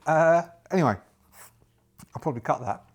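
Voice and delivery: normal talking voice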